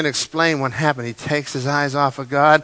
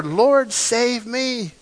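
mild yell; 7 semitones higher, much slower than usual. Retold or imitated. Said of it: "And he yells out".

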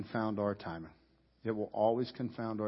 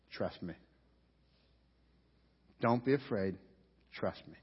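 much softer, lower, tender; gentle reassurance; 5 semitones lower, 5 dB quieter than usual. Retold or imitated. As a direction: hush him with calm trust. Jesus voiced reassuring Jairus.

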